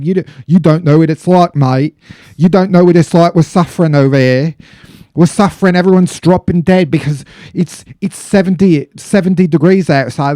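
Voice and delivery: a loud, bad British accent, mock suffering. Podcaster mocking Brits in a loud fake British accent. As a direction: whine like a Brit in a heat wave in a failed British accent. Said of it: "All of these Brits ... start saying".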